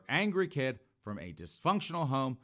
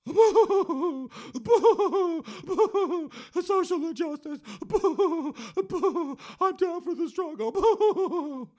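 shrieking, much higher and louder booing protester; hysterical Gen Z chick; 8 dB louder, much slower than usual. Acted. Mocking a young activist, hysterical shrieking boos.

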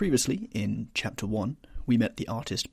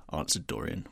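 plain reading voice

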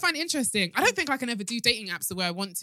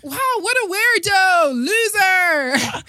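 high, loud mocking cry; mock outrage; 8 semitones higher, 8 dB louder, much slower than usual. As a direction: sneer it like a fake victim. Mocking fake catfish victims.

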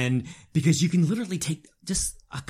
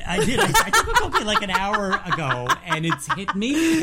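high, giggly stoned voice; laughing through being high; 5 dB louder than usual. Real, not acted. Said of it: "mommy's baked at the moment".

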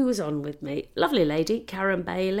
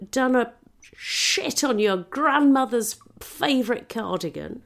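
loud, higher, exasperated comeback; indignant reply; 5 semitones higher, 5 dB louder than usual. Acted. Visiting comic answering the staring villagers, indignant. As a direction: answer back with mock outrage, leaning on the words.

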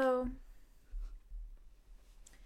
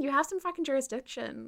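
usual speaking voice

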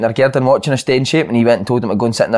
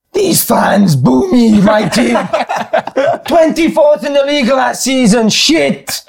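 high, slow ranting Italian manager; Italian accent; 11 semitones higher, much slower than usual. Retold or imitated. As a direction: rant at the fans like Di Canio, laughing. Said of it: "he was like".

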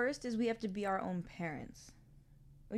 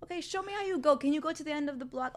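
higher, upbeat coaxing; encouraging parent; 8 semitones higher, 6 dB louder, faster than usual. Pretend. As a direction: urge the kid onward.

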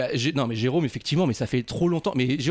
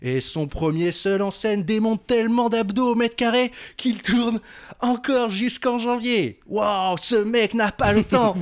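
upbeat presenter read; show host intro; 8 semitones higher, slower than usual. Acted. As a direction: hype up the guest like a host.

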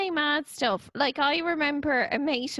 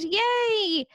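high, happy mock cheer; hammed-up yay; crowd cheer; 8 semitones higher than usual. Acted crowd cheering good news.

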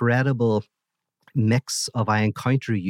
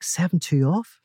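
"Seven two off?" jumps high in disbelief, rising at the end; incredulous; 8 semitones higher than usual. Acted. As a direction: ask "Seven two off?" as if it can't be serious. Seamus doubts God's order.

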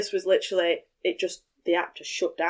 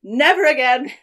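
emphatic vow; 5 semitones higher, 7 dB louder than usual. Retold or imitated. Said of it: "I was like".

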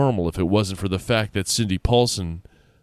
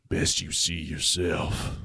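low, slow and quiet sheriff; cold flat warning, much lower; icy sheriff telling him flatly; 5 semitones lower, 7 dB quieter, slower than usual. Acted. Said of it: "he said flatly".

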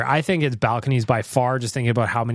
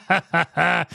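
high burst of laughter, then a word; real laugh; 5 semitones higher than usual. Real, not acted. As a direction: laugh at being told off. Host laughing at being scolded.

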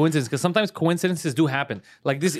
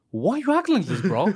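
mock defensive friend; 5 semitones higher than usual. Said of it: "he's like".

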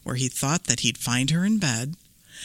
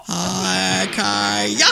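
ninja battle cry. Acted.

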